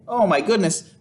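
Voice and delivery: plain reading voice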